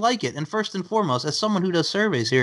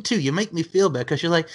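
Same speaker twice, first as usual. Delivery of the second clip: normal talking voice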